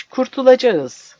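high, loud, slow and gleeful. A narrator's reading. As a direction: savour the word with glee. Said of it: "Sevinçten ellerini ovuşturuyordu". (sevinçle, yavaşça)

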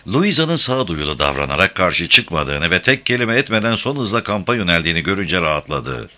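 usual speaking voice

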